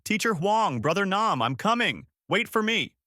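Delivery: high, restrained final call; mild shout. A narrator's reading. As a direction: call out high without real volume. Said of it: "shouting one last time".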